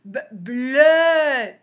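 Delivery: a lone "B", then "bleu" long and loud; sounded out. Shown as a demonstration. Teacher reading syllables for beginners, "B-, bleu" sounded out. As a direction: hold the vowel of "bleu".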